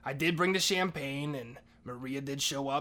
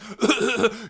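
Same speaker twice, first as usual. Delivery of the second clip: loud, high choking growl; raging roar; 9 semitones higher, 13 dB louder than usual. Retold or imitated. Tito raging after hearing of the kiss, choked roar. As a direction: roar through a coughing fit. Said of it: "his rage".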